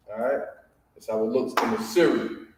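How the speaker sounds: ordinary talk, nothing marked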